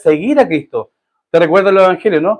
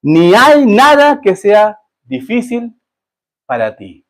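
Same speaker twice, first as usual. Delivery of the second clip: slow solemn climax; 3 semitones higher, much slower than usual. Acted. Scripture climax read slowly.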